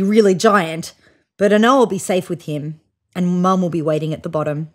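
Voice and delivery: plain reading voice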